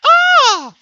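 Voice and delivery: a hammed-up startled shriek; mock scream. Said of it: "she goes".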